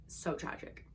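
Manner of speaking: whispered on a sigh. Real, not acted. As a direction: breathe it out in a whisper. Sighing after tragic scene.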